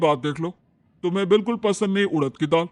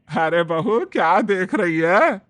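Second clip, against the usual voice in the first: louder, slow, drawn-out calling mother-in-law; nosy mother-in-law; 6 dB louder, slower than usual. Acted. Mother-in-law checking what her bahu is watching, loud and slow. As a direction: call out to the bahu like a prying saas.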